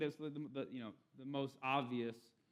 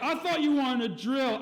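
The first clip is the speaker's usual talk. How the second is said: loud, high, slow mock pick-up line; sleazy guy comeback; 10 semitones higher, 10 dB louder than usual. Sleazy app guy answers the girl, loud, high and slow. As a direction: lean in with a smug, hammed-up leer. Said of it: "He's like".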